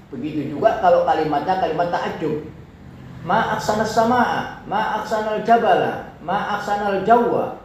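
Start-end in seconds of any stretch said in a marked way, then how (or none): 3.2-7.6 ma aksana, jabala and jawwa each said high and loud in wonder, rising toward the end; exclamation intonation. Shown as a demonstration. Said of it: "akan tinggi belakangnya". (intonasi ta'ajjub, tinggi di belakang)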